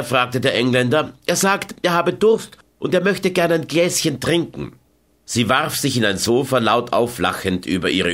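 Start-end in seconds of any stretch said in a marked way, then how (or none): 1.3-4.7 higher amused woman's voice; sweetly fib the translation; Carmen, playful lie. A narrator's reading. Carmen mistranslates for the Englishman, higher and amused.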